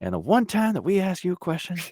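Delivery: lightly voiced [name], playful; [name] ribbing. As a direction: rib the guy who never stopped working.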